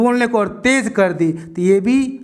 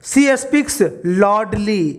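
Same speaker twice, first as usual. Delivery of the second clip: each word drawn out very slowly; slow dictation; much slower than usual. Shown as a demonstration. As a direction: read the example sentence very slowly for students. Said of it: "ये word आ गया". (बहुत धीरे, शब्द खींचकर पढ़ा गया)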